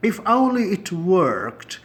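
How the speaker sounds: drawn-out sigh of regret; slow wistful demo. Shown as a demonstration. Teacher demoing a wishful English phrase, slow and drawn out. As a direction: stretch it out like a hopeless wish. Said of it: "تو یہ بھی ایک style ہوا".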